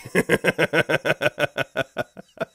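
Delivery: loud, high burst of laughter; big laugh. Real, not acted. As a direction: crack up in a long loud laugh.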